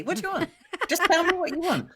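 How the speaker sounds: high, exasperated mock husband; impatient husband. Wife imitates her wood husband losing patience, high and exasperated. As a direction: snap back, fed up with the waffle. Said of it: "if I talk like that to my wood husband".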